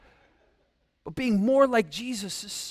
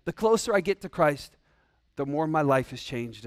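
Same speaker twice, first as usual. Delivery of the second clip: ordinary delivery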